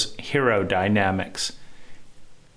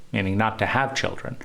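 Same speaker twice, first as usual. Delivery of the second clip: usual speaking voice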